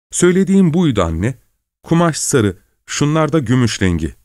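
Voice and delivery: high, lively showing-off; exclaiming. A narrator's reading. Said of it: "diye bağırdı". (tiz ve hevesli bir sesle)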